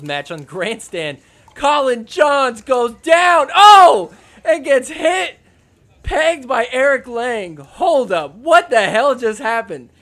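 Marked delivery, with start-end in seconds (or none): 1.5-9.9 loud, high, laughing disbelief; excited recap. Real, not acted. Host shouting to viewers about the hit, excited. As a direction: yell it out like a stunned fan. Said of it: "excited recap of hit".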